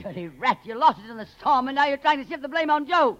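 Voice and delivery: high-pitched angry tirade; furious scolding. Acted. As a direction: tear into him for losing the food, pitch climbing. Connie accuses Ritz of lying.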